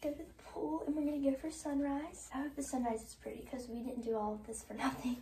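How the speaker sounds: groggy just-woke-up voice; croaky sleepy morning voice. Real, not acted. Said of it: "Ignore my crusty morning voice".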